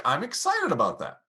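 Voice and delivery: eager rising finish; excited lift. Shown as a demonstration. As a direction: sound genuinely thrilled about it. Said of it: "But going up like".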